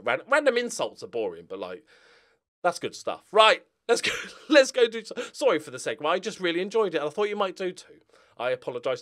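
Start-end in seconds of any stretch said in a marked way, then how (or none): none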